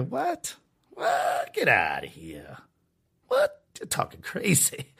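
a mock outraged gasp of disbelief; shocked disbelief. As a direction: voice leaps up in squeaky disbelief, then drops to a breathy hush. Retold own shocked reaction.

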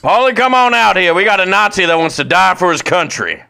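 loud, high calling out; movie soldier shout. Retold or imitated. Friend parodying a war movie scene, shouting the call. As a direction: call Paulie out like a movie sergeant. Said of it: "Inglourious Basterds scene parody".

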